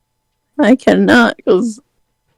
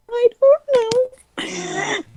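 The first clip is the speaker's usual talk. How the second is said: mock whine; 8 dB quieter, much slower than usual. Mock crying aside.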